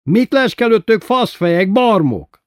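high, loud furious yell; hoarse yelling. A narrator's reading. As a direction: scream it at the men spying on her. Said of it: "ordította rekedten".

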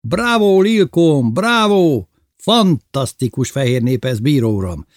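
high, loud cheers that ease off into praise; delighted shouting. A narrator's reading. Bébi applauding Lilkóm's three trout, shouting with delight. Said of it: "Bébi ordított a gyönyörűségtől".

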